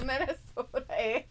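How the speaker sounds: lightly mocking Minnesotan accent; Upper Midwest accent. Shown as a demonstration. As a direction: tease the chat in a mild Upper Midwest accent. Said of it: "Y'all sound Michiganers".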